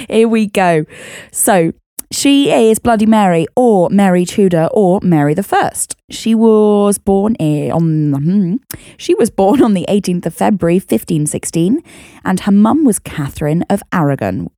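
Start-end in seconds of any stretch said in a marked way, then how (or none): none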